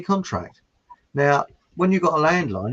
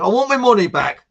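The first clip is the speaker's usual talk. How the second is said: mock angry complaint; 8 semitones higher, 9 dB louder than usual. Angry customer in joke.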